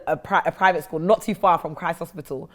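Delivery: usual speaking voice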